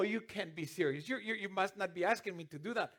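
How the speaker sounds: ordinary delivery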